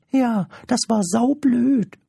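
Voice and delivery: very high, gleeful creature jibe; high-pitched impish teasing; cheeky little creature. Acted. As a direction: squeak it with a cheeky grin.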